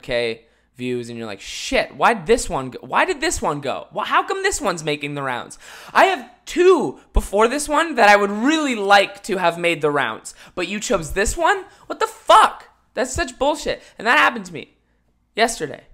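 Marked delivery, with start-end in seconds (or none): 1.4-12.7 higher and louder, building to a shout; exasperated rant. Retold or imitated. Ranting at the algorithm. 12.9-15.8 higher, louder indignant talk; worked up. Real, not acted. Still worked up.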